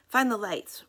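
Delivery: brisk loud order. A narrator's reading. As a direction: give the order sharply. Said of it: "ordered Mister Trapwood".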